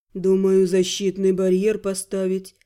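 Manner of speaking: low, grand wizard voice; colourful mage voice. Acted. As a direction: speak like an odd old mage.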